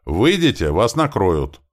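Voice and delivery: high, loud warning cry; yelling. Acted. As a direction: shout to warn them off. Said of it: "заорал кто-то из наших".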